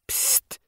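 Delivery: soft unvoiced psst; psst hiss. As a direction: hiss from hiding. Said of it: "A cat was signaling".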